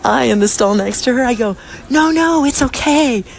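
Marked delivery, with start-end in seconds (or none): 1.9-3.2 encouraging. Retold or imitated. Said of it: "I like to use my voice".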